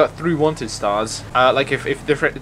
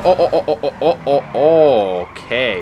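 ordinary talk, nothing marked

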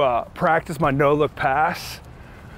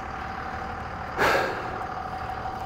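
long breathless exhale; winded sigh; 10 dB quieter than usual. Real, not acted. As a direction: blow out air, out of breath. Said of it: "I'm winded".

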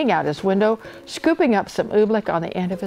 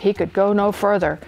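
plain reading voice

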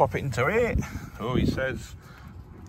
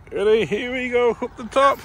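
excited cheer; 12 semitones higher than usual. Real, not acted. Excited cheer pushing child on zip line.

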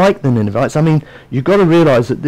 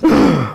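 sharp mock gasp of shock; 2 semitones lower than usual. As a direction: gasp as if scandalised. Imagining sinners sitting in church.

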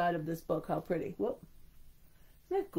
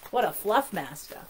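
ordinary delivery

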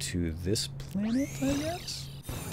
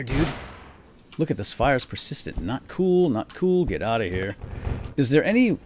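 ordinary talk

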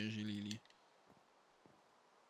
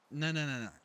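panicking gamer; 3 semitones higher, 6 dB louder than usual. Real, not acted. Panicking in game.

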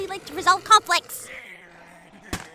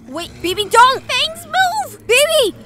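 high, loud frantic yells; desperate battle shouts; panicked shouting; 10 semitones higher, 11 dB louder, slower than usual. Acted. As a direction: scream to stop them. Ally charging into danger.

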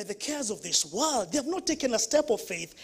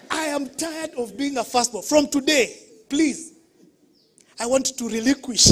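louder, higher pleading outburst; mock tearful plea; 3 semitones higher, 5 dB louder than usual. Firstborn pleading with parents at the meeting, louder and pleading. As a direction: plead like a fed-up child. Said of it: "but that day I was crying".

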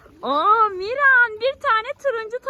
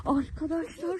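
ordinary talk, nothing marked

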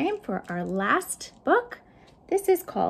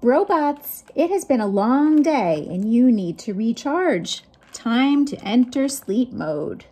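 louder, lively read-aloud; story reading to children; 4 semitones higher, 5 dB louder than usual. Acted. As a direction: call the robots to bed like a cheerful caretaker. Reads robot bedtime story.

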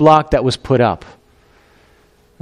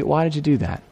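soft God; 7 dB quieter than usual. Retold or imitated. God asks softly.

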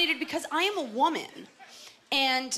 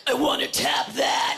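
slow, harsh, shouted caricature; comic head voice; 5 dB louder than usual. Pretend. Woman pretending to ogle a guy, mock crude inner voice. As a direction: put on a crude, forced shout. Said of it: "that's not how I talk in my head".

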